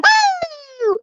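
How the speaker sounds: high mocking whoop; mocking imitation. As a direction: mimic her shrill whoop. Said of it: "You going".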